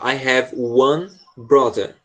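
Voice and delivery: very slow, careful teacher read; very slow model line. Shown as a demonstration. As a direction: spell it out slowly for the student. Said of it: "Isso, de novo". (leitura bem lenta e pausada)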